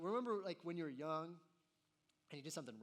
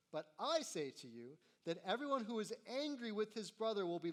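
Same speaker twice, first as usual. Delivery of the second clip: raised, emphatic reading of the verses; scripture reading; 3 semitones higher, 4 dB louder than usual. Acted. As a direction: proclaim the words of Jesus to the congregation. Reading Jesus' words from Scripture.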